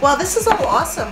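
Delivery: normal talking voice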